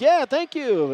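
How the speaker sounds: high, put-on thank-you; lightly hammed-up acknowledgement; playful mock reply. Commentator jokingly answering another Rhett's mom, mock-grateful.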